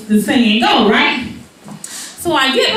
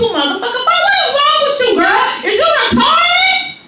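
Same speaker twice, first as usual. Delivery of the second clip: high-pitched scolding tirade; acted furious mom, pitch way up; mother's angry rant; 9 semitones higher than usual. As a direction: build to a high, exasperated yell.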